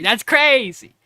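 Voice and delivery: loud mock disbelief; hammed-up shocked coach; stunned trainer. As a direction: cap the rant with a disbelieving shout. Trainer ends his stunned rant.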